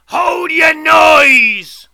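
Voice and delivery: huge, slow, menacing bellow; terrible shout. A narrator's reading. Convict startles boy among the graves, terrible shout. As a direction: roar it out, high and long drawn out. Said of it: "cried a terrible voice".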